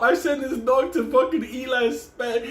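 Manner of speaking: ordinary talk, nothing marked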